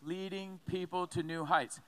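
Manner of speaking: slow, evenly spaced slogan; measured unison-style chant, word by word; group recitation. Shown as a demonstration. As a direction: say it slowly so a congregation can join in. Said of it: "Say it with me".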